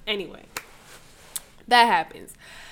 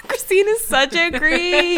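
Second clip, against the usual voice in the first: high, slow words through giggles; laughing; 8 semitones higher than usual. Real, not acted. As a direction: say it while cracking up.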